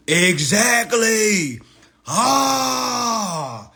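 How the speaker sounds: loud, high, drawn-out shout; exclaiming revelation. Retold or imitated. Reader reacting to the law's wording, exclaiming loudly. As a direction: cry it out like a sudden revelation. Comic outburst on reading the law.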